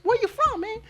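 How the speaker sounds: high falsetto questioning voice; mimicked coworker. Coworker asking the student where he's from, mimicked in falsetto. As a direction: put on a high-pitched coworker voice. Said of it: "me preguntó y me dijo".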